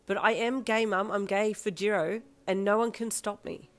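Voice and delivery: angry outburst. Acted. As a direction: stand up to her mother. Daughter confronts mum angrily.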